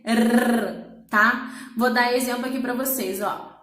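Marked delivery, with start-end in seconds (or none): 0.1-1.1 long rolled R on the tongue tip; trilled R. Shown as a demonstration. Teacher shows the lone R between vowels, trilled. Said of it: "Ele sempre vai fazer".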